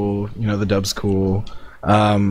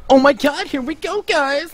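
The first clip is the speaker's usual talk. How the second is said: very high, gushing mock cat-girl voice; overexcited cat girl. Mocking the dub's cat girl for her friends, very high gushing. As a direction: squeal it like a hyper anime girl. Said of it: "a little too, um, excited".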